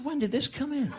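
high disbelieving query; incredulous question. Retold or imitated. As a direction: play the naive innocent, high. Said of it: "I said".

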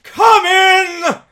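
loud, high, booming spirit voice; booming ghost. Acted. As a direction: boom a giant's welcome. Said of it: "exclaimed the ghost".

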